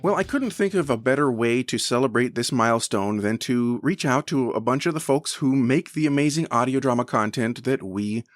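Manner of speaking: normal talking voice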